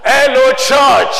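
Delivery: very loud, drawn-out shout; high, booming call, stretched slowly. Real, not acted. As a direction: rouse the whole congregation with it. Final, loudest call to congregation.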